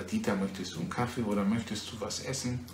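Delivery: low, soft model questions; unhurried example questions for a learner; normal conversational speed. Shown as a demonstration. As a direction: demonstrate everyday pace, quietly and evenly. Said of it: "in dieser Geschwindigkeit".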